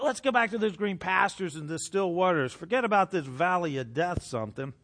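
normal talking voice

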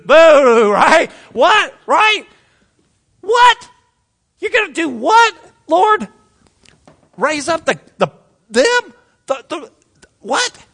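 very high, incredulous squawked questions; stunned disbelief. Acted. Listener shocked at God's plan, high sputtering disbelief. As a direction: sputter in shrill, halting disbelief. Acts shock at the Chaldeans promise.

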